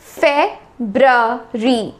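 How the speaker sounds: फेब्ररी broken slowly into feb-bra-ree; slow teaching demo of फेब्ररी with both r sounds; syllable by syllable. Shown as a demonstration. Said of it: "सही pronunciation है वो है".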